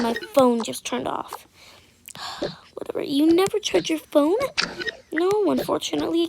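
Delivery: ordinary talk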